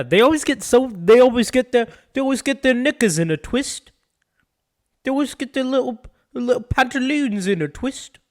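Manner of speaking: very high-pitched British caricature; mock British accent. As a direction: fuss like a caricatured Brit. Mocking Brits in their accent.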